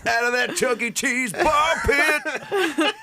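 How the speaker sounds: high shout breaking into laughs; laughing shout. Pretend. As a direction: yell it while cracking up. Shouted mock lyric, laughing.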